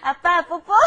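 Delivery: high, loud pretend child, laughing; giggly high-pitched kid voice; kid to dad. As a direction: play a little kid asking dad for a peck. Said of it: "kinda like a kid and a mom".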